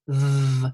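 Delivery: "v" held with a buzzing vibration; sustained voiced "v". Shown as a demonstration. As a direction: turn the voice on for a loud, buzzing "v". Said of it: "you're turning your voice on".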